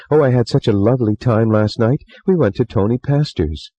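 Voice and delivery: put-on bubbly girl gushing about her night out; chattering young model. A narrator's reading. Model Tessie voiced.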